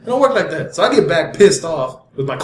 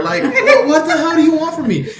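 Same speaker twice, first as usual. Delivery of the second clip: high-pitched, sputtering protest; comic squeal of exasperation; exasperated pleading; 9 semitones higher than usual. Retold or imitated. Driver fed up with the cop's questions, exasperated.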